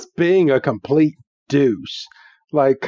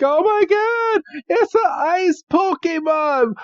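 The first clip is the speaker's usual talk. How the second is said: hammed-up terror in a high-pitched voice; panicked mock shriek. Girl sees an ice Pokemon, panicked mock shriek. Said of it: "and just stammers. She's like".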